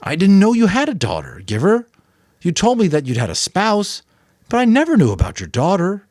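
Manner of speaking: put-on a boy startled by news of a daughter. A narrator's reading. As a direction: blurt it out in surprise.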